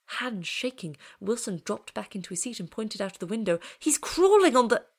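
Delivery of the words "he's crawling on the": high, loud panic breaking off; frantic cry cut short. A narrator's reading. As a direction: shout it until the words fail. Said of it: "words ended with a choking rattle".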